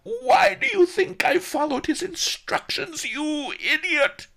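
loud, very high outraged shout; exasperated high yell. A narrator's reading. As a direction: shout down the fool, stretching the insult. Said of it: "Master Li shouted".

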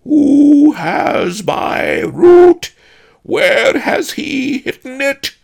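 slow, booming, high ghostly demand; haunted spectre, Tibetan accent. A narrator's reading. Spectre interrogating the pawnbroker about his root, haunted roar. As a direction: thunder it out as an angry ghost. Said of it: "haunted voice with a thick Tibetan accent".

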